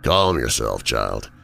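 pat him on the head with your voice; condescending. Acted. Villain talking down to furious James, condescending and low. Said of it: "he definitely sounds condescending".

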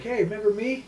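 a hint of a sly high greeting; lightly voiced creepy guy; creepy stalker greeting. Stalker greets the woman he followed, lightly voiced.

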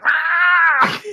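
long, high, loud mock scream; comic panicked "aah"; imitated freak-out scream. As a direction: scream as if debris is falling on you. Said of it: "Lily's reaction to the falling debris".

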